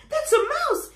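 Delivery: high, loud frightened pleading; terrified shrieking. Acted. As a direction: elephant begging the mouse, high, loud and scared. Elephant terrified of mouse.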